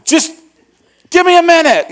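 high, loud impatient plea; exasperated shout. Retold or imitated. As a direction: push her away, fed up. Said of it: "and I was mad".

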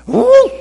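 very high, loud yip-like woof; dog bark. Acted. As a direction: yelp a happy bark. Dog answers one pound.

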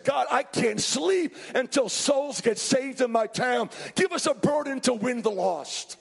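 impassioned revival prayer from the pulpit; fervent preaching. Real, not acted. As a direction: preach it hard, pleading with God. Preaching at full force.

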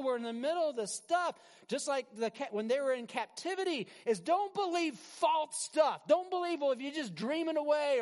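high, loud, impassioned sermon; forceful preaching. Real, not acted. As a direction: build to a shouted warning from the pulpit.

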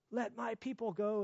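put-on high, proud little-girl voice; little daughter's voice. Father imitating his toddler daughter's proud answer. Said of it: "She'd say".

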